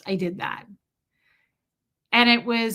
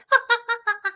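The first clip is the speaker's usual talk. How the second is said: imitated dog whine; 13 semitones higher, 4 dB louder than usual. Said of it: "And Bo's like".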